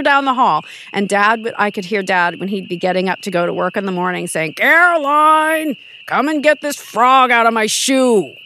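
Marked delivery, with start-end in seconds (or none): 4.5-8.3 higher, louder, slow exasperated call of "Caroline"; dad calling out. Retold or imitated. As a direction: holler like a fed-up father down the hall. Dad calling from hall mornings.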